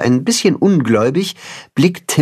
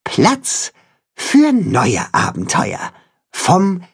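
raised, wondering chicken voice; little chicken voice; 8 semitones higher, slower than usual. A narrator's reading. Chicken asking the cat, amazed. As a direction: ask in wide-eyed wonder.

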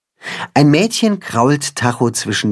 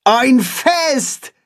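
much higher excited cry; excited call; 13 semitones higher than usual. A narrator's reading. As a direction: burst out with delight. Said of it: "ruft Holstein".

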